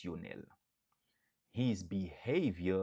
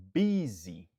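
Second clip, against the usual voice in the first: Busy louder with BU stressed; loud model word, first syllable stressed; 6 dB louder than usual. Shown as a demonstration. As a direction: punch BU in Busy. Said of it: "Nou aksantye sou premye silab lan".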